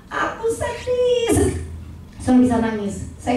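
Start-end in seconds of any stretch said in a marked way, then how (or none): 0.1-1.6 much softer, slow, high and breaking; tearful whisper-like repeat. Shown as a demonstration. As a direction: let the voice crack on the repeat. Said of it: "Dengan berkata". (mengulang dengan suara pecah, pelan dan lirih)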